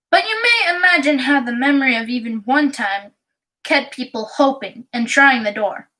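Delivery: usual speaking voice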